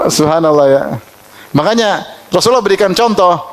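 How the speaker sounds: usual speaking voice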